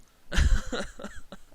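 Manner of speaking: loud, high laugh; burst of laughter. Real, not acted. Laughs at own refusal of accent.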